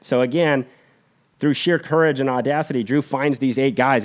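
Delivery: normal talking voice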